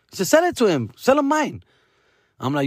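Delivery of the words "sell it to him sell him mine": high-pitched imitation of a son; higher voice of an urging son; Diego. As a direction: imitate your kid insisting, pitched up. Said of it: "But my son, Diego, my oldest".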